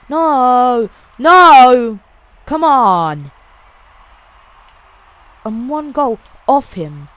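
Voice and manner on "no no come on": loud cries of dismay; frustrated gamer yelling; desperate shout. Real, not acted. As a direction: shout your plea at the game. Pleading as the game goes wrong.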